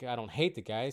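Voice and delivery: normal talking voice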